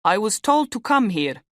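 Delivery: put-on Romanian accent. A narrator's reading. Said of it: "in a thick Romanian accent".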